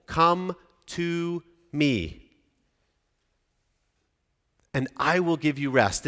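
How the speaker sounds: slow, tender invitation; gentle invitation. Acted. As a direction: offer rest like a gentle call. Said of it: "Jesus is saying to them".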